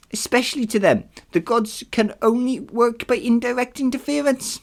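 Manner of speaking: high, put-on Nereid voice. Nereid explaining the gods' limits to a hero, high put-on voice. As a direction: pitch it far up as a sea nymph explaining. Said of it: "the Nereid told me".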